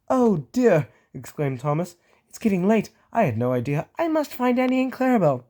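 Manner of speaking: high, flustered engine; flustered excuse. Acted. Thomas hurrying away from the ghost, flustered. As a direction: make a hasty escape.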